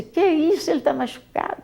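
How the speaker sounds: high, shocked protest; indignant protest. Retold or imitated. Daughter protesting to the police that he is hurt, high and indignant. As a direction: push back at the police. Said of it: "Ela falou assim".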